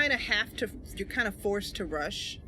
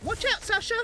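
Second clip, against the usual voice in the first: much higher urgent call; character warning call; 14 semitones higher, 4 dB louder than usual. Acted. Mercy warning her companion Sasha, high urgent call. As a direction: warn a friend in the heroine's voice.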